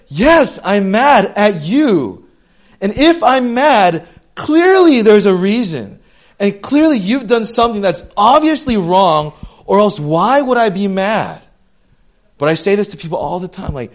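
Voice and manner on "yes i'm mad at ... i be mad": loud, high-pitched angry comeback, stretched on key words; indignant retort. Acted. Preacher voicing an angry person answering "are you mad", indignant. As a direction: fire back in exasperated, emphatic outrage.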